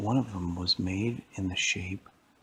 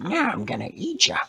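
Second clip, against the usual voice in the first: high, loud cartoon villain voice; menacing old lady; 13 semitones higher, 6 dB louder than usual. Old lady lands to catch the cookie, gloating screech. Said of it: "And she said".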